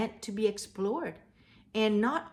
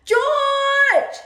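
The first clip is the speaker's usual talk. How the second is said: high, loud call across the street; 15 dB louder than usual. Acted. The man in the yellow hat calls up to George, loud call. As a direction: shout his name up to him. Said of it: "he heard someone called".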